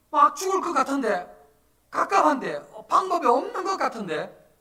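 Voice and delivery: very high, loud cry of desperation; impassioned preaching. Real, not acted. Pastor voicing believers with no way out, impassioned preaching. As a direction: cry out the helplessness at sermon pitch. Acts despair while preaching.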